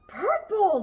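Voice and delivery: high gasp then a bright loud cry; excited shout. A narrator's reading. Storyteller voicing mice who find purple, excited shout. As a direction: cry out the colour in delight. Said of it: "they all shouted".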